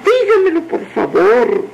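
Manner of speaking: high-pitched begging, falling at the end; eager pleading. A narrator's reading. Girl begging the woman to tell her, pleading. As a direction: plead like an impatient little girl.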